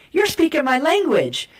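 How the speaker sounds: high, delighted exclaim; mock a thrilled listener; excited reply. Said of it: "the person who speaks Romanian is like".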